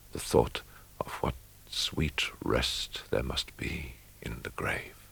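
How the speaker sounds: breathy hush, barely voiced; whispered. A narrator's reading. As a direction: whisper it like a secret longing for rest. Dreamy thought of rest in grave.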